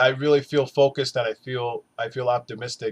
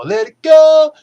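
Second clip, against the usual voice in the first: emphatic shout; 13 semitones higher, 14 dB louder, slower than usual. Real, not acted. Urging viewers to let go.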